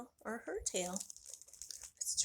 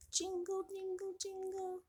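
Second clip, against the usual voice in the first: jingling bell sound; 8 semitones higher, 6 dB quieter than usual. Acted. Cat bell sound in story.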